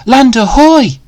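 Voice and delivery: high, loud cry of land spotted; excited cry. Acted. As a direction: call it out from the ship, bursting with joy. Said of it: "Danny cried".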